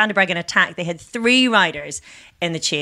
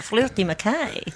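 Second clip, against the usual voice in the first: slow, playful Scottish rendering; Scottish accent; 4 semitones higher, 4 dB quieter than usual. Pretend. Trying out a Scottish-sounding name, slow Scottish accent. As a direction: say the name slowly in mock Scottish. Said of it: "sounds like a very Scottish name".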